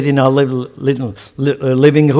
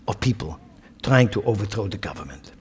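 whispered aside; 6 semitones lower, 10 dB quieter than usual. Real, not acted.

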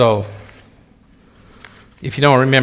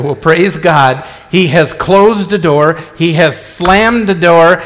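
slow, louder proclamation, each phrase weighted; slow loud preaching; 5 dB louder, slower than usual. Retold or imitated. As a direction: preach it out with conviction. Preaching reply to trouble.

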